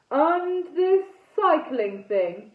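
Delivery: loud, slow, ponderous disdain; booming knight ghost. A narrator's reading. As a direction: boom it slowly as a puzzled old knight. Knight ghost continues his complaint.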